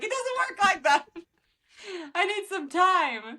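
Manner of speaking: mock exasperation, stretched out; wife refusing quick makeup with husband; exasperated wife. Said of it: "I'm like".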